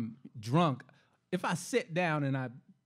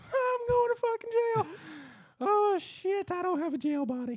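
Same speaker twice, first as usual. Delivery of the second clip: mock panic; 13 semitones higher than usual. Drunk driver seeing cop lights, mock panicked high voice. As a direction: panicky inner voice, much higher. Panicked inner voice when pulled over.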